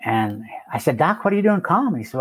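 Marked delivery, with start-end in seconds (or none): 1.0-2.0 high, quick surprised question; startled by who's calling. Retold or imitated. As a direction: caught off guard by the call. Surprised call from neighbor doctor.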